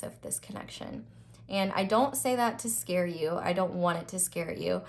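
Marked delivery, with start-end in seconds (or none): 0.0-1.0 whisper. Real, not acted. Ominous warning about envious people.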